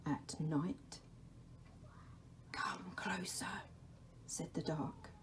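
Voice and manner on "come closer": a soft, unvoiced whisper; the Dark's whisper. The Dark calls to Laszlo in a whisper. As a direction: breathe his name from the shadows.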